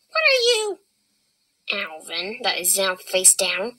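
usual speaking voice

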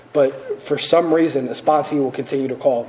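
ordinary delivery

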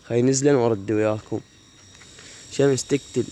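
ordinary talk, nothing marked

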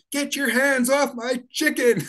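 high, drawn-out mock yell; Tooker. Activist yelling at guards grabbing his rubber chicken, mock outraged yell. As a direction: yell it slowly in a high, indignant voice. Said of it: "Tooker's yelling".

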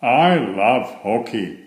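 ordinary delivery, nothing marked

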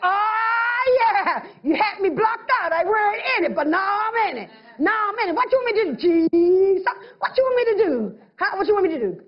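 excited new believer asking Jesus what to do; excited newcomer. Retold or imitated. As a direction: play the thrilled new kid begging for a job. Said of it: "you're more excited".

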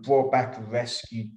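ordinary talk

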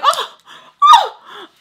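high, loud sassy wind-up. Retold or imitated. Granddaughter sassing grandma, high and loud. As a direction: get ready to lecture her, mock high.

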